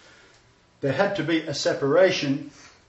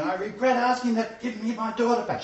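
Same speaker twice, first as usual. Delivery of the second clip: higher-pitched imitated begging; retold desperate plea; 8 semitones higher than usual. Pastor retelling a mother's regretful prayer for her daughter, pleading. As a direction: raise the pitch as you voice a distraught mother. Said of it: "she was crying hysterically".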